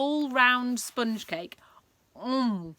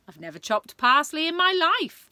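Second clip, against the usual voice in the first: indignant Queen; 8 semitones higher, 8 dB louder than usual. Acted. Queen retorts to the Sultan.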